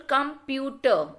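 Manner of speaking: "computer" slowly in three beats; syllable by syllable. Shown as a demonstration. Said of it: "divided into three different syllables".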